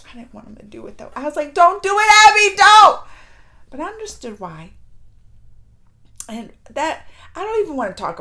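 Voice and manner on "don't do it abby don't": louder, higher retold cry of warning; retold desperate plea. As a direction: plead urgently as if calling to stop them. Retold plea to doomed boy.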